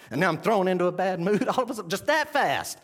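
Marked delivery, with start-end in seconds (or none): none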